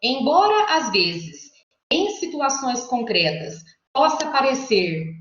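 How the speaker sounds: "Embora às vezes, em situações concretas, possa parecer" read slowly, phrase by phrase; reading in sequence. Shown as a demonstration. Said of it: "ó, vou ler pra vocês". ("Embora às vezes, em situações concretas, possa parecer" em leitura lenta e compassada)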